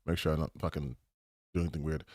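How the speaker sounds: dropped to a low, hushed mutter under his breath; quiet aside. Real, not acted. Streamer pausing mid-rant, muttering quietly to himself. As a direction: pull way back and say it half to yourself. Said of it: "Like, hold on".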